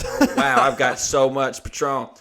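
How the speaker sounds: fake-thrilled, laughing through it; mock excitement. Mock delighted reaction to gifts.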